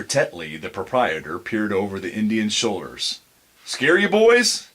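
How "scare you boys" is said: high, loud, slow playful taunt; teasing. Acted. Mister Tetley teases the boys he just startled, high and loud. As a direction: needle the boys you just spooked.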